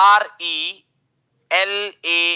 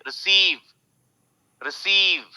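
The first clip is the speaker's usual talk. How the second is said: Receive with faint री, raised long सीव; second-syllable stress; 4 semitones higher than usual. Shown as a demonstration. Teacher showing re hardly matters, stretched SEEVE. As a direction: lean high on सीव, skip over रि. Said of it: "री से ज्यादा मेरे को कोई मतलब नहीं है".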